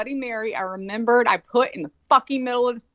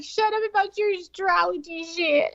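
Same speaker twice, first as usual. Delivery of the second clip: dismissive Lola; 9 semitones higher than usual. Retold or imitated. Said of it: "Lola's like".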